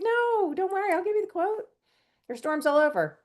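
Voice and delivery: very high-pitched imitation of reassurance; breezy reassurance. Manager telling a customer not to worry, high breezy dismissal. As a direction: wave it off in a high, light voice. Retold manager waving away her apology.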